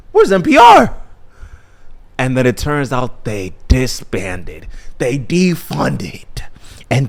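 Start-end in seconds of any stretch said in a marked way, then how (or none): 0.1-1.0 high, loud cartoon outburst; imitated indignant shriek; Cartman. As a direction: cry it out in mock alarm. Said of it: "And then he realizes".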